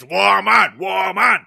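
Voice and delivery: loud caveman-like Muppet bellow; hammed-up wild monster voice; Animal. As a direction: bark it like a crazed puppet monster. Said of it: "like fucking Animal from the Muppets".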